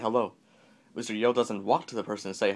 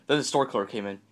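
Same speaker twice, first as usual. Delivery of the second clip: ordinary delivery, nothing marked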